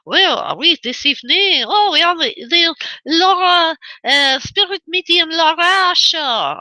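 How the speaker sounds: hammed-up French accent, drawn out. Shown as a demonstration. Host showing listeners her accents, French accent. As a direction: put on a playful French accent. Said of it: "I could do a French accent".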